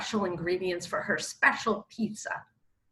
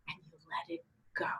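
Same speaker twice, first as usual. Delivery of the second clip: soft, quick whisper; hushed letting go; 16 dB quieter, much faster than usual. Shown as a demonstration. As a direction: breathe it out in a whisper. Hushed advice to drop the fight.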